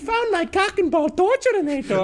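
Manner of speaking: very high accented cartoon villain, laughing at the end; Doctor Doofenshmirtz. Retelling an ongoing joke, high cartoon villain voice. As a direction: screech it as a gleeful mock villain. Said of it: "more like Doctor Doofenshmirtz".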